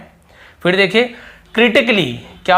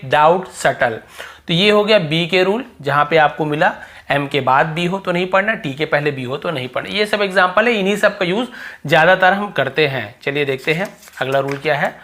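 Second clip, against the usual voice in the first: normal talking voice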